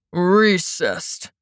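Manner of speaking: low, grudging growl from the judge. A narrator's reading. Judge grants the recess with a low growl. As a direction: grind the word out in annoyance. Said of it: "the judge growled".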